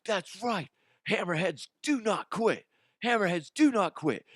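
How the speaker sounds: high, fervent rallying cry; retold pep talk, pitched well up; pumped-up coach. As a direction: fire up the kids with a held-back yell. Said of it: "I am just so pumped up".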